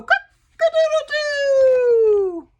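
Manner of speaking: very high, loud, drawn-out rooster crow; mighty cock-a-doodle-doo. Acted. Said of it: "gave a mighty crow".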